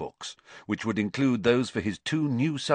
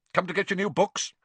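quick, higher-pitched brisk question; abrupt; 10 semitones higher, faster than usual. A narrator's reading. Manager asks Harry about his books, abruptly. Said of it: "he said abruptly".

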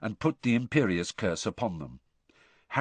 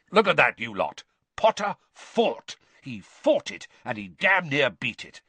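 higher, emphatic teacher's exclamations; rousing praise; 5 semitones higher, 4 dB louder than usual. A narrator's reading. Teacher showing off Potter to the class, excited and forceful. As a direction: crow about the student's fight to everyone.

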